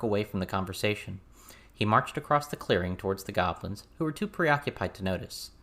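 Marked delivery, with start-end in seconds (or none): none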